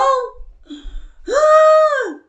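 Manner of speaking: high, loud voiced gasp. Real, not acted. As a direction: gasp loudly, high and worked up. Ends her riled-up outburst.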